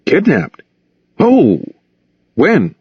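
high startled questions; shocked reporter. A narrator's reading. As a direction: gasp it out in disbelief. Reporter shocked by kidnapping news.

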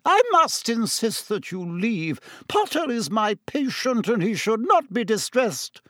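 high, indignant matron; angry. A narrator's reading. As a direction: insist crossly in a high voice. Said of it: "said Madame Pomfrey angrily".

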